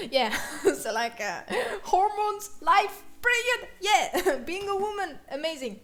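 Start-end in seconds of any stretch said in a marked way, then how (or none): 1.8-5.8 high, loud mock cheer; mock celebration. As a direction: call each word out with fake enthusiasm. Sarcastic cheer about period hormones.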